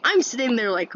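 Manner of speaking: usual speaking voice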